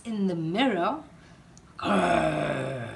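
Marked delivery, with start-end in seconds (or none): 1.8-2.9 low crocodile growl. A narrator's reading. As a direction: growl like a scary croc.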